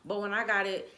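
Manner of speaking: ordinary talk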